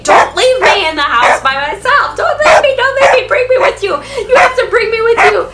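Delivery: high, loud whiny dog begging not to be left; dog pleading to its owners, high and loud. Retold or imitated. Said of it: "like, saying".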